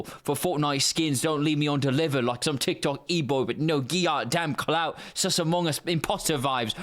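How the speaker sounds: brash British slang patter; British NPC accent. Acted. British NPC leaving mate a voicemail, cocky British accent. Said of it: "British N P C Max Aura".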